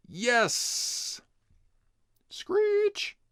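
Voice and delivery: very high squealing screech; screeching brakes. A narrator's reading. Narrator voicing the bike brakes, high screech.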